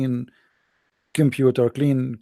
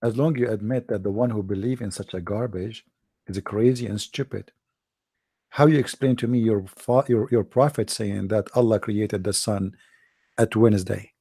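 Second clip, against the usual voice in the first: lower, softer, patient; calm reply; 6 semitones lower, 5 dB quieter than usual. Retold or imitated. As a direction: lay the trap calmly. Speaker's calm reply in the story.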